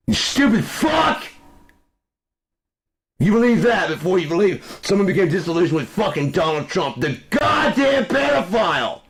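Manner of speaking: real rage, bellowing insults; furious shout. Real, not acted. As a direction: scream it at the enemy. Furious rant at right-wingers.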